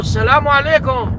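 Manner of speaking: high salaam, brisk and clipped short; same salaam said briskly; quick greeting. Acted.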